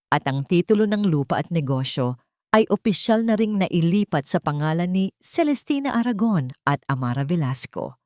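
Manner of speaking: plain reading voice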